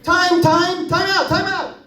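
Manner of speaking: put-on high, eager little-boy call; a boy calling timeout to his coach, high and eager; kid calling time.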